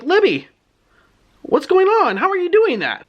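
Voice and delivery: put-on very high, bewildered boy voice; shocked boy. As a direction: imitate a frightened kid's questions. Skipper shocked by Libby transforming.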